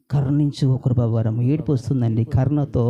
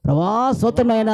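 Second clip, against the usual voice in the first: mock loud prayer cry; 7 semitones higher than usual. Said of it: "గట్టి కేకలడం".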